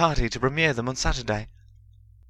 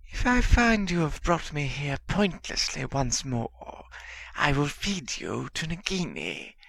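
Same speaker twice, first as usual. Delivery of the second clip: menacing hiss; 6 semitones higher than usual. A narrator's reading. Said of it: "Harry hissed".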